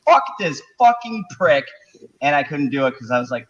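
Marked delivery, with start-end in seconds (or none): 0.1-1.6 mock angry outburst. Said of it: "I wanted to let go with a string of".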